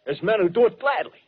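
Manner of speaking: fierce insistence. Acted. Insisting men will hunt men.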